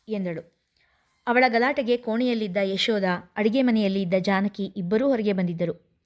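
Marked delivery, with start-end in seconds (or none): none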